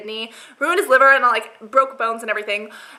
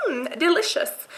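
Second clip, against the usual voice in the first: savoring; 5 semitones higher, 4 dB quieter, slower than usual. Retold or imitated. Acted enjoyment of the drink.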